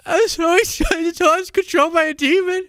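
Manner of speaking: high mocking squeaky voice; mocking Shay. Mocking a YouTuber's line in a squeaky high voice. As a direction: mimic Shay in a silly high pitch. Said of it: "He's like".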